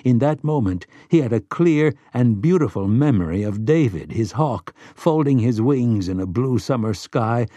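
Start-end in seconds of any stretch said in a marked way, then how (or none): none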